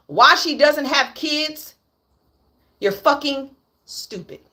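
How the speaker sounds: ordinary delivery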